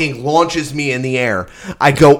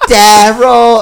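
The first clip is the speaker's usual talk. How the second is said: raised playful call; calling out a name; 9 semitones higher, 7 dB louder than usual. Retold or imitated. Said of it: "She was like".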